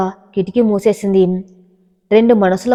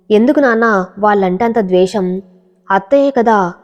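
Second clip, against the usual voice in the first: plain reading voice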